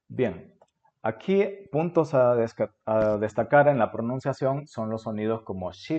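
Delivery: ordinary talk, nothing marked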